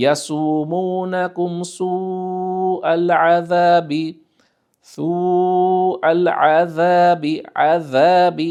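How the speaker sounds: slow, melodic Arabic recitation; Quran recitation. Shown as a demonstration. Teacher reciting a verse for students, slow melodic recitation. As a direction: chant the verse slowly in tajweed.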